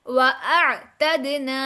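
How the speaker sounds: व अअतदना slow, ain held still; measured व अअतदना without releasing the ain; no bounce on ain. Shown as a demonstration. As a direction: stretch व अअतदना, bouncing only the द. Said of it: "आइन के ऊपर कलकला नहीं होगा".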